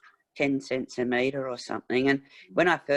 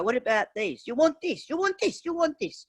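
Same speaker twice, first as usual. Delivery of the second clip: very high, pushy repeated offer; pushy shopkeeper Max; 13 semitones higher than usual. Retold or imitated. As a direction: push the goods on her in a squeaky old-man voice. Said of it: "Or he'd go".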